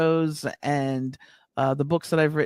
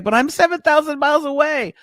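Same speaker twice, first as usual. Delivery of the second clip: exasperated disbelief; 11 semitones higher, 5 dB louder than usual. Retold or imitated. Telling her landlord she's far away, incredulous high protest. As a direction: pitch jumps way up in mock disbelief. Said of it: "And I was like".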